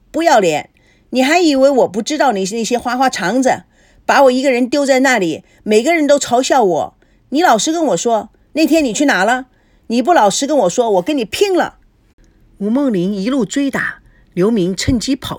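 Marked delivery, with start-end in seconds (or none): 0.1-11.8 high-pitched angry scolding; furious tirade. Acted. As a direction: berate a cheating man, voice raised. Wife hits and berates man.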